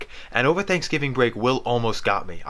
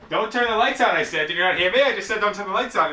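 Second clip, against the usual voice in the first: insistent yelling; 8 semitones higher than usual. Real, not acted. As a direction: bark it at him, annoyed. Insisting at brother.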